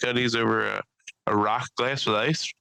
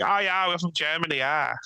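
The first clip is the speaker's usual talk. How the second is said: light put-on German accent; German accent, friendly; 4 semitones higher than usual. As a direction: mimic the German couple cheerfully. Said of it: "they sounded like".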